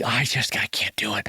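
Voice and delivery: hushed, near-whispered reluctance; real soft shy voice. Retold or imitated. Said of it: "The voice goes real soft".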